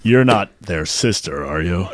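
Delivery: loud, drawn-out mock-solemn questioning; slow, put-on celebrity voice pleading for an answer; Patrick Warburton. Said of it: "That was more like Jedi".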